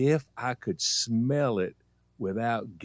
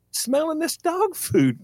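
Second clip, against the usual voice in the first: put-on kid's voice; 3 dB louder, slower than usual. Kid answers mama.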